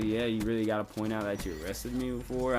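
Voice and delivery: ordinary talk, nothing marked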